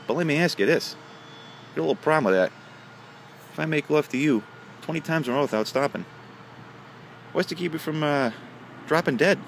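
a lightly voiced cheeky son asking the catch; son's punchline voice. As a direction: pitch it up as the cheeky son springing the question. Son's punchline question to leprechaun.

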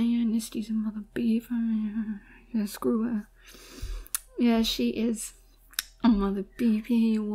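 soft conspiratorial whisper. Real, not acted. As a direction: hiss it like a secret insult. Whispered trash talk.

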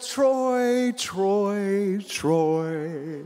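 long drawn-out name calls, stepping down from high; calling out joyfully. Retold or imitated. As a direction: imitate an overjoyed holler, easing with each call. Acts old man calling his name.

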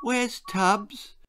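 put-on a small boy anxious about his rabbit; worried boy. Boy repeats his question.

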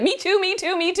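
high eager mock plea; squeaky eager little voice; eager me too. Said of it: "Jennifer's waving from behind the camera like".

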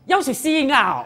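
hammed-up cry mid-prayer; mock scolding. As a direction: imitate someone breaking off a chant to scold. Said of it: "我看过人家这样".

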